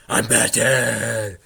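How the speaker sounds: slow, loud mock Batman line; imitating Viner's Batman. Vlogger quoting an old Vine, mock Batman voice. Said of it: "that old Viner? He goes".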